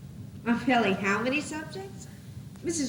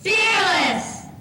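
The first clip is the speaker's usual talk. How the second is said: big rising shout, much louder and higher; shouted fearless; 7 semitones higher, 9 dB louder than usual. Acted. Cast member shouting the finale word to the audience, loud and high. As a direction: belt the word out like a triumphant cheer. Cast chants ending line.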